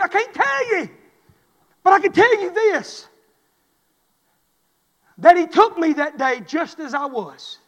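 loud, high, impassioned preaching; full-force preaching. Acted. Pastor to his congregation, loud impassioned preaching. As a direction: preach it out with everything you've got.

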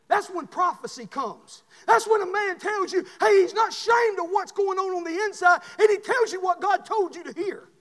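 high, shouted revival sermon; full-force preaching. Real, not acted. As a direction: drive it home loudly from the pulpit.

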